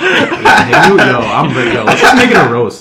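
loud laughing eagerness; laughing excitedly. Real, not acted. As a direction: laugh and get hyped. Eager for a roast.

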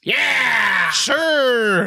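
high shouted Midwestern hype call; hammed-up upper Midwestern shout; upper Midwestern accent. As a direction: belt it out high, mock Minnesotan. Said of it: "upper Midwestern accent off that you just heard".

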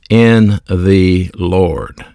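In the Lord drawn out very slowly; slow emphasis. Shown as a demonstration. Preacher stressing the source of the words, very slow. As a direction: lay each word down slowly, In the Lord. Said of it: "then he says".

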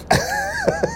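squeaky giggle at himself; a quick high laugh; high squealing laugh. Real, not acted. Laughing at his own nickname, a squealing giggle.

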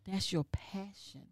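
slow, very soft, emotional; near tears. Real, not acted. Host recalls her former principal's words, near tears. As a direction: let it sink almost to a whisper. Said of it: "Don't be crying in here".

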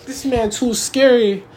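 a hint of a nervous churchgoer complaining; scared listener. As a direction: lightly play someone put off by the preacher. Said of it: "then be like".

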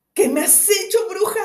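high, loud anguished outcry; desperate cry. A narrator's reading. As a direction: cry out in despair. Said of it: "pronunciaba desesperado".

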